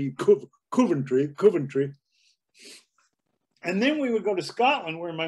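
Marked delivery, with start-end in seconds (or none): 0.7-1.9 "Coventry" said with a short oo sound, "Cuv-entry"; local Coventry. Shown as a demonstration. Correcting the outsider's way of saying Coventry, local pronunciation. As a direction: say "Coventry" the Midlands way, like "Kuv-entry". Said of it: "you don't say Coventry".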